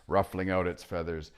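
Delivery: plain reading voice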